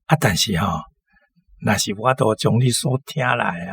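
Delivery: ordinary talk, nothing marked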